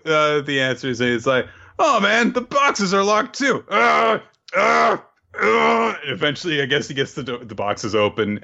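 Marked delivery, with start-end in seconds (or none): 1.8-6.0 much higher, louder, panicky hesitation; high flustered bumbling spy. Retold or imitated. Recapping the bumbling spy at the locked boxes, high and flustered.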